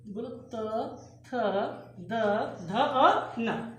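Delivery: त, थ, द, ध, न sounded one by one, tongue on teeth, very slowly; demonstrated consonants. Shown as a demonstration. Teacher models dental sounds for class, slow and clear. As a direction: model the dental row slowly for students to copy. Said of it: "बोलो देखा, ये".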